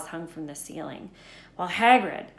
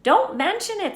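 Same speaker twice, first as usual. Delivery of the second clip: gruff; 9 dB louder than usual. Acted. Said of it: "said Hagrid gruffly".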